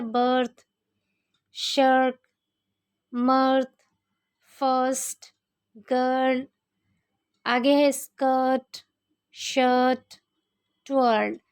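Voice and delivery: ir words with a drawn-out अ, as in बर्थ; शर्ट, स्कर्ट with the अ stretched; example words read out. Shown as a demonstration. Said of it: "थोड़ा सा खींच के पढ़ा गया".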